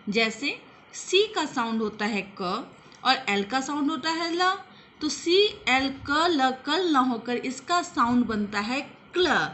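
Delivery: ordinary talk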